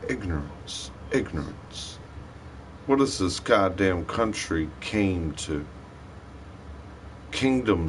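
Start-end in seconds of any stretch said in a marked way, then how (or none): 0.1-2.0 muttered word. Real, not acted. Muttered verdict.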